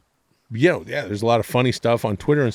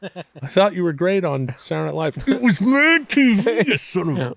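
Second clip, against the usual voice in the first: clueless fan; 9 semitones higher than usual. Pretend. A fan confusing his TV shows, high put-on voice. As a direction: play a fan getting his shows wrong.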